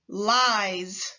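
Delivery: "lies." ending in a voiced z; teacher stretching the final z; final buzz. Shown as a demonstration. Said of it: "Repeat after me".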